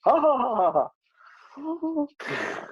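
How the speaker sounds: high hammed-up fake laugh; mock a laugh on cue; forced laugh. Acting blue's forced laugh.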